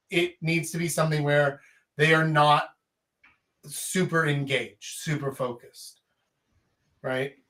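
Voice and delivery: normal talking voice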